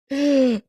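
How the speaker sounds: short real laugh. Real, not acted. Streamer laughing at his own joke. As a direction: break into a quick laugh.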